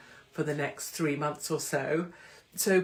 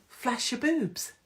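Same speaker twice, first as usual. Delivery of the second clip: higher, drawn-out cheeky urge; naughty inner voice; 8 semitones higher, slower than usual. Acted. Comedian voicing the thought in their head, naughty high voice. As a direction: voice a mischievous thought, slow and high. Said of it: "something in my head just went".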